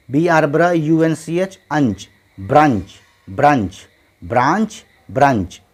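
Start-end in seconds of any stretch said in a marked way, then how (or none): 4.2-4.8 ब्रांच with an open long aa, "braanch"; long "aa" vowel. Shown as a demonstration. Teacher contrasting the a sound for learners, long vowel. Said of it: "ये ए की आवाज़". 5.1-5.6 ब्रंच with a short uh, "brunch"; short "u" vowel. Shown as a demonstration. Teacher contrasting the u sound for learners, short vowel. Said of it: "यहाँ यू की आवाज़".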